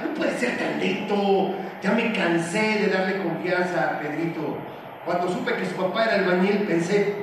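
usual speaking voice

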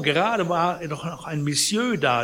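protesting sick man. A narrator's reading. Sick man insists a visitor was there, high puzzled protest. Confused patient protests to housekeeper.

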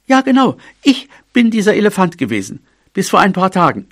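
high, eager mouse voice; earnest high-pitched insistence; insistent young mouse. A narrator's reading. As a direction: confirm it keenly in a small high voice. Mouse insists he was that elephant.

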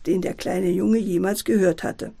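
plain reading voice

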